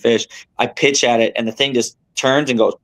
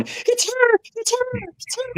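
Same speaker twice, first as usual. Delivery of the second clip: squealing excitement; 6 dB quieter, slower than usual. Retold or imitated. Excited angler lands big fish.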